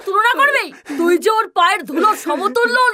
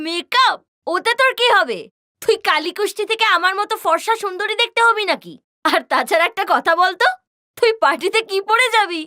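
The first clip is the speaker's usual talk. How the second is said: normal talking voice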